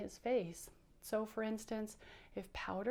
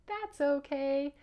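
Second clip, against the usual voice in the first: slow, higher, drawn-out puppet line; accepting reassurance; 5 semitones higher, 5 dB louder, slower than usual. Pretend. Bouncer accepting he must clean up, slow and higher-pitched. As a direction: bounce back, stretching it out. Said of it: "He'll say".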